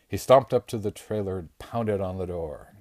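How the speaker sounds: usual speaking voice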